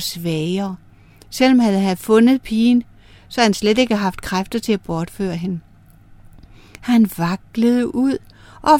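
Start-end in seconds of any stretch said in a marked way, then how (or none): none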